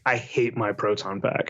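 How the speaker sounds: low, laughing mock despair; mock lament. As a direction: grumble it through a laugh. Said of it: "I was like".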